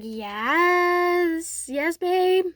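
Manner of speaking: high, stretched-out acted yes; excited confirmation. You confirming the gift to Deku, excited. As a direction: confirm it in a lifted, delighted voice.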